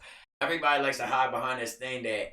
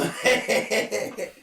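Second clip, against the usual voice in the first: a high, loud laugh breaking out; burst of laughter; 10 semitones higher, 4 dB louder than usual. Real, not acted. Amused guest laughing at the host's question. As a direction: laugh out at the friend's mixup.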